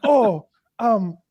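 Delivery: very high, hesitant lady's voice; imitating a baffled, polite woman; puzzled lady. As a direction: ask timidly, mock Japanese lady. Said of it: "And she's like".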